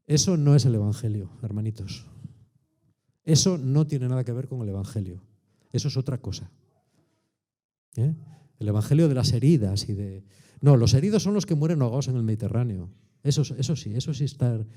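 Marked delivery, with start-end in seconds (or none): none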